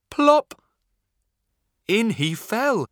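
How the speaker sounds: high loud plop; excited. A narrator's reading. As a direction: burst out with the plop. Wolf falls in the pot.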